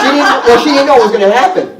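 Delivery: loud, high answer through laughter; laughing protest. Real, not acted. Storyteller answering whether the woman gave warning, laughing protest. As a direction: blurt it out, amused and emphatic.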